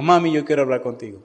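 ordinary talk, nothing marked

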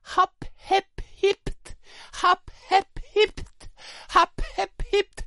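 very high squeaky clown yelps; cheerful agony cries. Pretend. Clown in agony, cries painted in cheerful colours. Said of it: "Son gritos de agonía".

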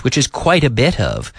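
ordinary talk, nothing marked

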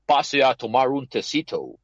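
put-on slow, drawn-out American accent in Spanish; mock American accent inviting Kenshin to tea; gringo accent. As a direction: imitate a gringo doctor offering tea, slowly. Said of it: "con su acento claramente gringo".

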